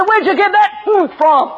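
high, heated challenge; imitating an irate interviewer; mock berserk demand. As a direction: fire it back in hammed-up fury. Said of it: "He went berserk".

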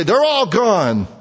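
high, slow proud announcement; proud report. Pretend. Servants reporting to King Saul, high and slow. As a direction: report back with satisfaction.

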